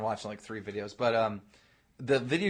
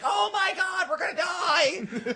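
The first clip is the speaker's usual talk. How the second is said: hammed-up high panicked shout; overreacting fanboy; slower than usual. Fanboy fakes being a nuke victim, overacted panic. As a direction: wail it way too high, dragging out the end. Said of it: "fanboy in there who's overreacting".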